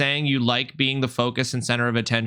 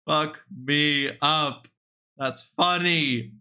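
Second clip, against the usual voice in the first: usual speaking voice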